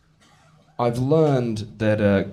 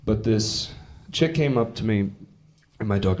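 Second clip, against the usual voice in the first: ordinary talk, nothing marked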